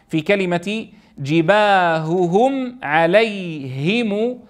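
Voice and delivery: جباههم with the ه clear and drawn; slow recitation. Shown as a demonstration. Teacher to students, sounding out جباههم slowly. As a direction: give both ه sounds of جباههم full weight.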